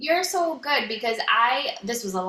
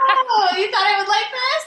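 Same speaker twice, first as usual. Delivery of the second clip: mock husband; 10 semitones higher, 9 dB louder than usual. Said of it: "He's like".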